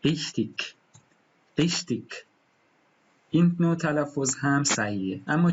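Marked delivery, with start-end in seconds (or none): 0.0-2.2 ریختیک with a Berlin ending, like eshtik; ریختیک said the Berlin way, the ch turned to sh; Berlin accent. Shown as a demonstration. Teacher imitates the Berlin pronunciation for the class. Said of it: "لهجهٔ برلینی ممکنه ... بشنوید".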